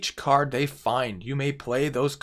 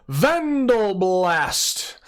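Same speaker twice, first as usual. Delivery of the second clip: dramatic announcement; 5 semitones higher, much slower than usual. Acted. Card reviewer booms the next card name, slow and grand. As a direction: stretch it out like a game-show host.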